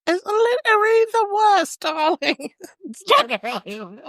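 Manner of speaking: hammed-up posh English accent to tease a friend; posh English impression. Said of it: "Give us a glimpse".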